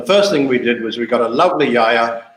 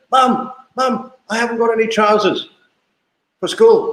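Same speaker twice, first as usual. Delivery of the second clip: put-on high-pitched child voice, rushing, getting louder on the last words; panicky little son; 9 semitones higher, 3 dB louder than usual. Son calling to mum before school in a high child voice. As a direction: play the son rushing to mum without his trousers.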